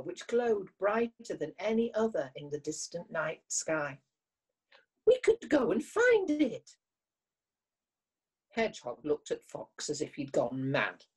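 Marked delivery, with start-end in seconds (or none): 5.0-6.8 eager. Acted. Fox eager about the star.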